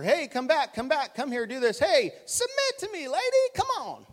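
high-pitched mock holler, dragging out the ends; hammed-up, high-pitched bossy yell. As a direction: imitate yelling bossy orders at a wife. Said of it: "I'm just yelling at her".

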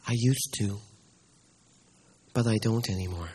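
low, soft and sad; a touch of tears in an old man's voice; tearful. Acted. Old pastor quietly admits to the boy he lost the gift, tearful. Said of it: "he was crying".

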